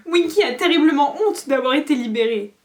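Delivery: high, loud squeaking elf, ashamed; a shamed house-elf squeaking; ashamed squeaky elf. A narrator's reading. Winky's elf voice.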